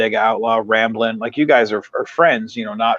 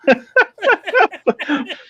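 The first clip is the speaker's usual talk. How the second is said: high burst of laughter. Real, not acted. Reacts to "Paul brothers" joke.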